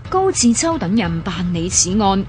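ordinary talk

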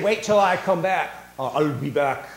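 a light hint of Arnold's I'll be back; Schwarzenegger line. Preacher joking as Jesus with a light Schwarzenegger touch. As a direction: lightly mimic the action-hero threat. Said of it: "Arnold Schwarzenegger".

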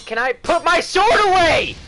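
high, loud plea building in frustration; exasperated yelling. Real, not acted. Gamer pleads with the game to sheathe the sword, exasperated yell. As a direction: yell at controls that won't obey.

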